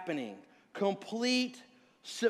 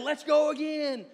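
high, eager imitation of a kid; retold eagerness; 6 semitones higher, 4 dB louder than usual. Son asking dad for another ride, imitated eagerness. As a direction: play a boy keen for more.

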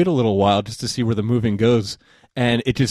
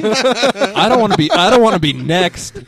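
higher, louder scared brother; hammed-up frightened refusal; mock panic; 10 semitones higher, 5 dB louder than usual. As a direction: play the brother too freaked to step outside. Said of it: "He's like".

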